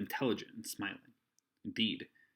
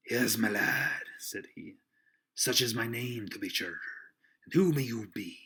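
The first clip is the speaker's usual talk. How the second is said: hearty innkeeper; 4 dB louder than usual. Acted. Silver greets the boy at his inn, hearty seaman. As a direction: play a hearty old sea-cook greeting a lad.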